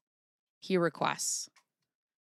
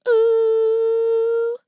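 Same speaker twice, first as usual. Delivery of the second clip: loud high whistling ooh; high whale ooh; 8 dB louder than usual. Acted. Imitating whale call, long high ooh. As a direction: squeal like a beluga.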